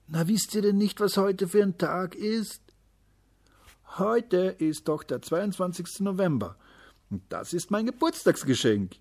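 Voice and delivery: put-on high, proud kid's voice; boy's voice. A narrator's reading. Boy proudly telling classmates about his birthday present.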